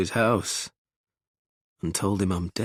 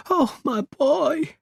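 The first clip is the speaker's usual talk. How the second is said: tearful relief; 5 dB louder, much slower than usual. Acted. Mum sobbing, hugging son.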